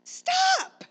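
very high, loud holler across a mall; retold yell. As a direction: yell after a son to halt. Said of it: "I yelled in the mo".

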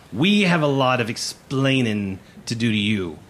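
loud, drawn-out scolding; stern first mate. Acted. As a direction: lean hard on each word of the order. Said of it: "Mister Plug stares directly at you".

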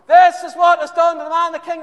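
very loud, high herald shout; shouted proclamation. Acted. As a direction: bellow it down the street.

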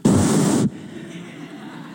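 long exhaled breath; breath sigh. Shown as a demonstration. Preacher demonstrating breath to congregation, long exhale. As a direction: let a breath out audibly.

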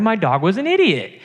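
ordinary talk

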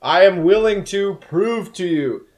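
high, slow booming demon cat; slow booming creature voice. Acted. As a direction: boom it out like an otherworldly cat. Cat offers to take an oath.

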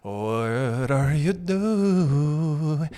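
very slow, drawn-out goofy pit bull; Pauly D. Acted. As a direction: stretch the last word out endlessly. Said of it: "pit bulls are like".

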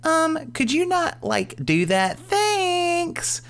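very high, slow sarcastic mom voice; a child parroting a sarcastic mom, mock-polite; very high and slow, mocking soccer mom; kid copying soccer mom voice. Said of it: "And he goes".

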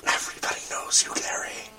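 whispering with a lost voice; raspy whisper. Real, not acted. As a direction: breathe the words, voice gone. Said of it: "I have no voice".

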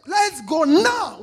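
slow, loud proclamation; drawn out rallying cry; bold call. Acted. Preacher voicing a can-do crowd, slow bold call.

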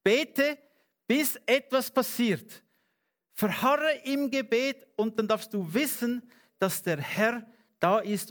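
fervent preaching. Acted. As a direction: drive it home to the congregation.

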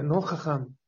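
ordinary talk

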